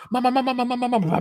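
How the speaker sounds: high, frantic calls for mum; urgent repeated cry, pitched right up; retold panicked calling. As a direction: imitate a panicked kid at a locked door. Acting out banging on mum's door.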